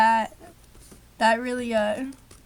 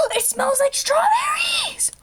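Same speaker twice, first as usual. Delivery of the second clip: much higher, louder, slower excited shriek; delighted squeal; 7 dB louder than usual. Real, not acted. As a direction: squeal with real delight at the scent. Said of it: "mentally recuperated from whatever that was".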